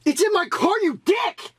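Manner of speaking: real rage, yelling; furious shout. Real, not acted. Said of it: "I lost my mind a bit there".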